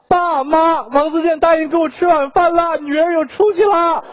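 hammed-up, high-pitched excited announcement; overjoyed phone call. Daughter phoning her parents with good news, wildly overjoyed. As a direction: proclaim it high, stretching the last phrase. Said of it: "立刻拿起电话".

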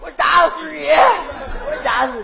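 hammed-up yell of a threat, drawn out slow at the end; mock furious shout. Jokingly threatening his partner with a mock shout. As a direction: pretend rage while laughing.

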